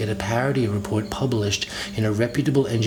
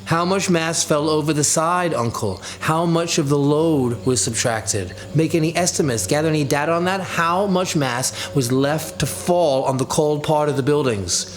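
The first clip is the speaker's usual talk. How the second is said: taunting call; 6 semitones higher than usual. A narrator's reading. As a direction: needle him from afar. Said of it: "I taunt Claudius, calling out to him".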